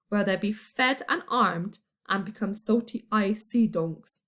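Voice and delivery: ordinary delivery, nothing marked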